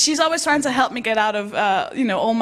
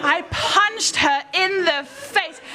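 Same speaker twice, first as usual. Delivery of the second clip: slow, drawn-out, higher-pitched retelling; slow motion; 6 semitones higher, slower than usual. Retold or imitated. Comic reliving the punch to her friend, slow motion. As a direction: stretch each word out for horror. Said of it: "I saw this happen in slow motion".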